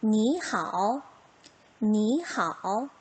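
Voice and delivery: 니하오 drawn out slowly, 니 rising, 하오 dipping; third tone becomes second. Shown as a demonstration. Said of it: "이 성으로 바꿔서 소리 한번 내봅시다". (니하오의 니를 이 성으로 올려 천천히)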